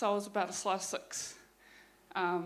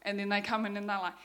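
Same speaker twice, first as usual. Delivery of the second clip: ordinary talk, nothing marked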